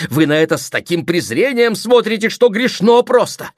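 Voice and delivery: loud, high, sustained tirade; indignant rebuke. Acted. Sister rebuking her rich brother, indignant rebuke. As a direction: berate him passionately.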